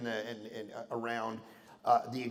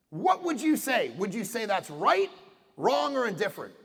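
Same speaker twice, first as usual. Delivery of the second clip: forceful retold challenge; 6 semitones higher, 4 dB louder than usual.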